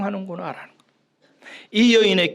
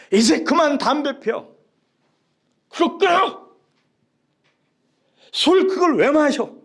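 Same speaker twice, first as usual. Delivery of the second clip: mock angry scolding; 9 semitones higher, 5 dB louder than usual. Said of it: "막 소리치고 화를 내요".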